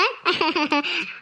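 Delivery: short soft chuckle; teasing laugh. Acted. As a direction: laugh at her little act. Laughing at the fake crying. (ಅಣಕದ ನಗು)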